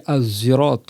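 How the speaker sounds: "Az-zirat" said with a z between zay and sod; third reading. Shown as a demonstration. Teacher demonstrating the third recitation. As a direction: sound "Az-zirat" with an emphatic z. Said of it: "une troisième récitation".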